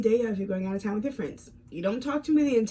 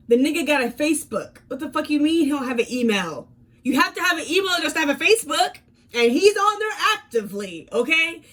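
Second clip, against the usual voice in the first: louder and higher, heated retort; angry; 6 semitones higher, 3 dB louder than usual. Retold or imitated. Calling out her boyfriend's lie, angry. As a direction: tear into his excuse.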